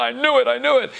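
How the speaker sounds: high-pitched smug crowing; self-satisfied. Retold or imitated. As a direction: crow it high, pleased with yourself. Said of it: "like, self-satisfied".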